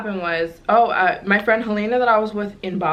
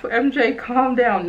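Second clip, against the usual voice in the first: self-calming; 6 semitones higher, slower than usual. Retold or imitated. Telling herself to calm down.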